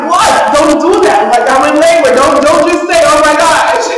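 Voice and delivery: retold rattled outburst; alarmed protest. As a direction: push back in a startled panic. Nurse says oh my gosh, alarmed.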